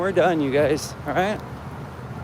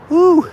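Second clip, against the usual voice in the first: cold whoop; 10 semitones higher, 6 dB louder than usual. Real, not acted. Chilly in Reno.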